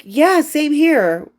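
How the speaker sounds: slow, high, drawn-out mock agreement; sarcastic reply. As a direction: stretch it out, dripping with sarcasm. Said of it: "I was like".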